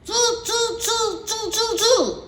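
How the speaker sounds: high, playful mocking monkey tsk-tsk; monkey chatter. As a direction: mimic the peddler like cheeky monkeys. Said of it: "shook both their hands back".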